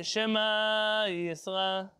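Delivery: slow, drawn-out chant of the verse; slow prayer recitation. Shown as a demonstration. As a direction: recite it slowly, word by word. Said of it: "They say".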